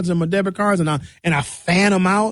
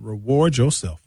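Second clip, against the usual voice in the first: ordinary talk, nothing marked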